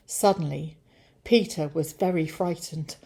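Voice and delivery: ordinary delivery, nothing marked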